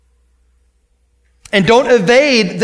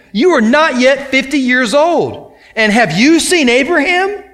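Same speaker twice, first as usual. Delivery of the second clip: higher-pitched, incredulous challenge; acted incredulity; 5 semitones higher than usual. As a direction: question it as a disbelieving crowd would.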